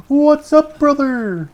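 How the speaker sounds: big high hype yell; loud mock bro greeting; bro shout. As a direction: hail him like a gym buddy.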